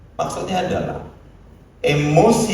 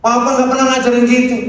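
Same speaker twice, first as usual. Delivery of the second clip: higher, louder offended protest; a father insisting he never taught that, raised voice; indignant father; 10 semitones higher, 5 dB louder, faster than usual. Retold or imitated.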